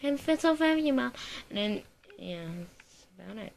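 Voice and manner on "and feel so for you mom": mock gushing. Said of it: "And I'm like".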